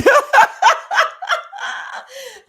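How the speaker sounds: a big, high squealing laugh that bursts out; high shrieking laugh. Real, not acted. Laughing out loud at a viewer's joke, high shriek. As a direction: let a shrill laugh burst out of you. Reacting to a funny comment.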